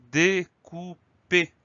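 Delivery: "découper" slowly split into syllables. Shown as a demonstration. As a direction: sound out "découper" slowly for spellers. Teacher sounds out new word. (« découper » dit lentement, syllabe par syllabe)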